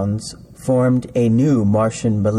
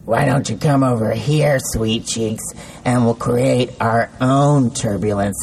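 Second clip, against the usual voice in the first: put-on lecherous old man. A narrator's reading.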